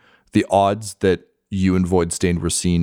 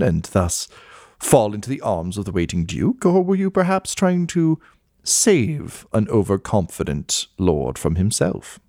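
mannered society gossip with swooping pitch; skull gossip columnist, intrigued. Acted. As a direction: relish it like an old society columnist.

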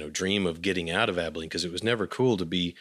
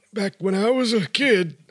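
put-on high, loud creaky old-timer voice; old man voice; 13 semitones higher, 6 dB louder than usual. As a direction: talk like a crusty old man. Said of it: "I have to use my old man voice for this".

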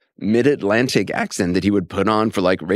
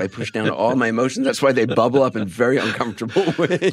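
higher, talking through real laughter; laughing self-mockery; 4 semitones higher than usual. Real, not acted. Guest joking to interviewer about bottled-up emotions, laughing. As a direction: joke at your own expense, laughing as you go.

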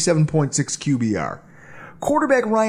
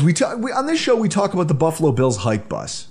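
ordinary talk

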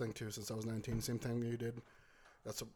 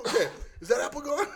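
laughing; 12 semitones higher than usual. Retold or imitated. Guy returns.

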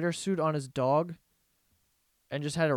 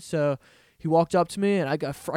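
usual speaking voice

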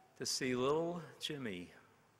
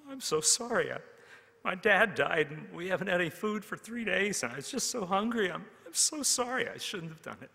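put-on much higher, a touch of sobbing in the child's voice; lightly voiced hungry boy begging forgiveness; Jimmy confesses to the teacher through tears; tearful plea; 9 semitones higher, 6 dB louder than usual. A narrator's reading. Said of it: "sob uncontrollably".

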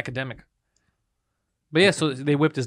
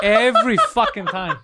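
laughing; 7 semitones higher, 3 dB louder, slower than usual. Real, not acted. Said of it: "laughing exasperation".

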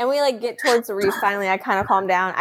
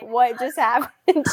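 laughing, bewildered; 7 semitones higher, slower than usual. Retold or imitated. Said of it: "I am like".

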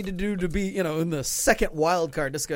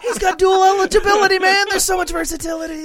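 very high, loud gushing; mock hype; 12 semitones higher, 6 dB louder than usual. Mocking the team's plan for a pitcher, gushing squeal. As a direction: squeal it with fake excitement.

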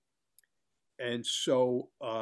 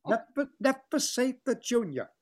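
high, fussy insistence; prissy grand star, pitched high; imitating Billy DeWolf; 10 semitones higher than usual. DeWolf insisting to Carson, fussy and high. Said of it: "that's exactly the way he talks".

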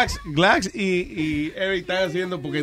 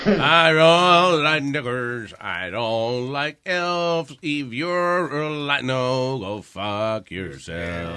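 mock bigot drawl; 4 semitones lower, much slower than usual. Mock slow racist drawl.

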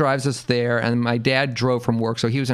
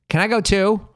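higher-pitched, mildly imitated kid asking; eager little sister; 9 semitones higher, 4 dB louder than usual. Sister asks to come along, eager. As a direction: pipe up like a hopeful sister.

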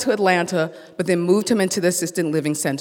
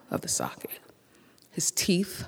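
whisper; 9 dB quieter than usual. Real, not acted. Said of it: "horrified description, whispered".

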